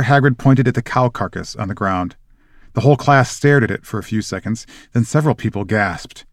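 normal talking voice

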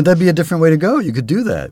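ordinary talk

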